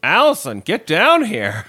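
loud, high holler; mock calling out. Billy summoning Allison to the basement, hammed-up shout. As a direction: yell it up the stairs.

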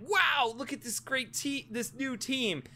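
high-pitched, softened gushing wow; mock amazed crowd. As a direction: play the awestruck fan, high and light. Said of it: "and we're like".

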